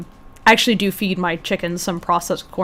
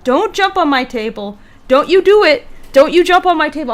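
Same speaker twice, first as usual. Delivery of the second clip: firm, louder warning to a pet; stern warning; 7 semitones higher, 7 dB louder than usual. Real, not acted. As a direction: scold the chicken, getting louder. Scolding chicken, rising warning.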